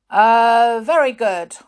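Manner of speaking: loud, high drawn-out hesitation; flustered schoolmaster. A narrator's reading. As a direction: cover being caught out with loud praise. Grimm caught out by the answer.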